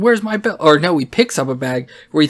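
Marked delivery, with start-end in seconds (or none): 0.0-0.6 retold yell. Shawn yelling about his missing belt, retold as mock yell. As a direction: imitate yelling without raising the volume. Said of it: "he starts yelling".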